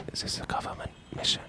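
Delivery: hushed secret whisper; conspiratorial whisper. Acted. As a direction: whisper it so no one overhears. Asking about secret spy mission.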